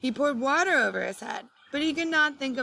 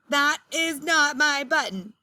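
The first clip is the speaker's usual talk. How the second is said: slow, louder, higher shout; 5 semitones higher, 4 dB louder than usual. A narrator's reading. Toad shouting at Frog over another wrong button, slow shout. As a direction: hammer each word out in frustration. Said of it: "shouted Frog".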